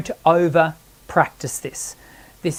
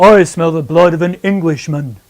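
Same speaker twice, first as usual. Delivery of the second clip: teacher demos the giant's line for the student; 4 semitones lower than usual. Shown as a demonstration. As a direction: rumble it like the beanstalk giant. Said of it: "the giant from Jack and the Beanstalk".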